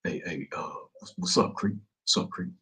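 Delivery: soft, timid stammer; caught-out snitch stammering softly; scared. Retold or imitated. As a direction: shrink back and mumble nervously. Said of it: "He's scared to death".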